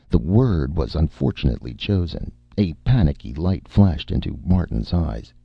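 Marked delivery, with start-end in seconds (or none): none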